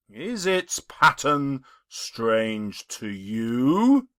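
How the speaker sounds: slow, heavy spectral voice; solemn ghost. Acted. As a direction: weigh each word like a chain. The ghost speaks.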